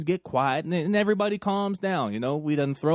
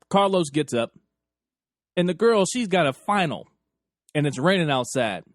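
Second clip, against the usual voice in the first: usual speaking voice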